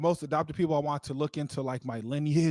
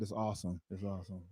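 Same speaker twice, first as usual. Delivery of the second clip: ordinary talk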